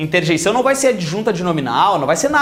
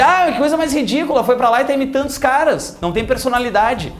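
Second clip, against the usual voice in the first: higher, scornful complaint; mock disdain; 5 semitones higher than usual. Teacher mimics a gaúcho scorning a chiador, higher and contemptuous. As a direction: sneer at someone copying Cariocas. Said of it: "aí tu vai dizer".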